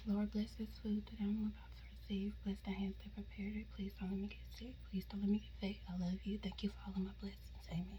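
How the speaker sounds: fast hushed grace before a meal; whispered prayer. Real, not acted. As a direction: whisper the blessing quickly.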